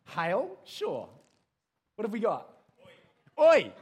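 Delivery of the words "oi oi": loud, high "oi"; attention-getting shout. Acted. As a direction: yell to grab the crowd's attention. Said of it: "say to get people's attention".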